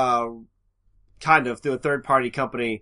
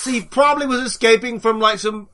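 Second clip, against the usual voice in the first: excited storytelling; 8 semitones higher, 8 dB louder than usual. Pretend. Jokey Indiana Jones plot retelling.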